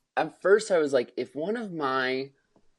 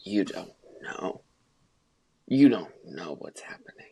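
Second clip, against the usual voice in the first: low, lower voice, whispering the line; hushed Benji; 5 semitones lower than usual. Retold or imitated. Retelling the film, friend whispers Benji's hushed plea. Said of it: "Benji comes in and he's like".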